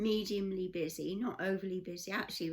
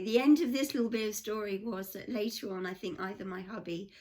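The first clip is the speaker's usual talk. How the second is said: usual speaking voice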